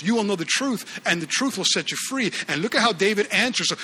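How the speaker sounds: ordinary talk, nothing marked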